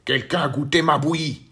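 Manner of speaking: loud booming papa bear; big gruff Papa Bear voice. A narrator's reading. Papa Bear growls at the empty chair, loud and big. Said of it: "dit Papa Ours d'une très grosse voix".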